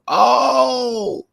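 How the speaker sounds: loud high "Oh!" of surprise; startled falsetto exclamation; shocked high yelp. Real, not acted. Shocked at headline.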